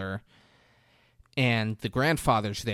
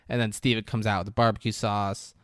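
ordinary talk, nothing marked

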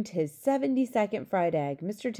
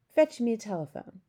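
higher-pitched royal order; commanding; 4 semitones higher than usual. A narrator's reading. Queen ordering her servant, commanding. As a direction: give it as a firm command. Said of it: "the Queen commanded".

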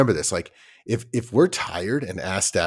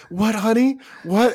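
high, sweet questioning parent; a doting parent asking again; mock confused parent; 13 semitones higher, 3 dB louder, slower than usual. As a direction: lean in like a puzzled mom. Parent straining to understand child.

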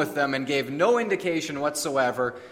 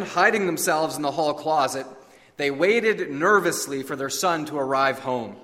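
ordinary talk

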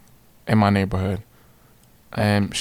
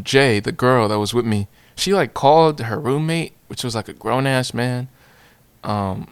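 plain reading voice

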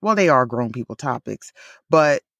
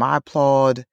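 ordinary delivery

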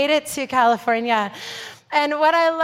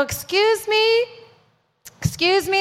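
polite call-out; 8 semitones higher than usual. Retold or imitated. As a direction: stretch it out sweetly to catch her attention. Calling out to an airline agent.

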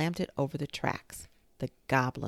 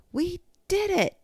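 very high, loud cheer; 6 dB louder than usual. A narrator's reading. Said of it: "Benny cheered".

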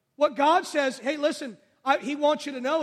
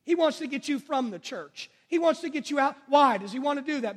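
ordinary talk, nothing marked

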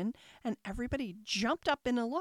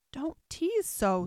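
mildly annoyed; 5 semitones higher, 4 dB louder, slower than usual. Acted. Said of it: "said Alice".

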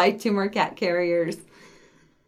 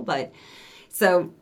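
ordinary talk

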